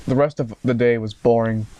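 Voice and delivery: ordinary delivery, nothing marked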